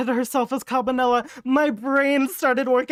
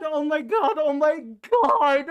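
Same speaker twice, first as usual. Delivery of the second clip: normal talking voice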